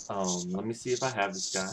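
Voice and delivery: normal talking voice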